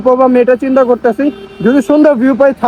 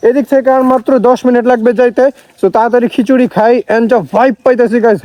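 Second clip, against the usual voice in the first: ordinary talk, nothing marked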